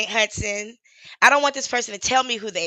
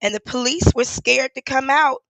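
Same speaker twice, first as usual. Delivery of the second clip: ordinary talk, nothing marked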